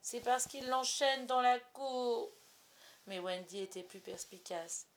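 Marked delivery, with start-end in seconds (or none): 0.0-2.3 put-on slow whiny child voice; whimpering. A narrator's reading. Little boy whining about the chained dog, slow and whiny. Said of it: "John pleurnicha".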